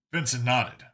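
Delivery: usual speaking voice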